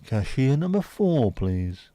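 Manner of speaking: loud, slow caller announcement; bingo voice cashier call. Pretend. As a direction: call the next customer like bingo. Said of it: "Bingo voice for cashier number two".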